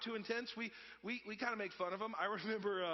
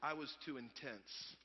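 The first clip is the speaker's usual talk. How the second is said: low, slow mock realization; hammed-up morning-after regret; imitated sheepish regret; 7 semitones lower, 8 dB quieter, slower than usual. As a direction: own up slowly, embarrassed act. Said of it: "checked out the internet the next day".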